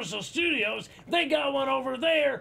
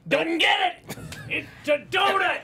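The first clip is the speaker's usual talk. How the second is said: raised, exasperated shouting; exasperated anger; 7 semitones higher, 4 dB louder than usual. Real, not acted. Still not understood after his donut spiel, exasperated anger. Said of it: "taking your raw anger out on me".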